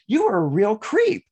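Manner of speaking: scolding someone who apologized, hammed up; mock scolding. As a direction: tell them off, hammed up.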